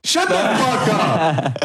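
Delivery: high, drawn-out indignant yell; mock-angry outburst. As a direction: blow up in playful outrage at a friend.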